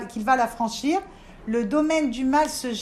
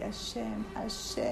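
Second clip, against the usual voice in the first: soft, breathy calling to Hashem; whispered prayer to Hashem; hushed calling; 4 semitones lower, 12 dB quieter, slower than usual. Retold or imitated. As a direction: murmur the name like a quiet plea.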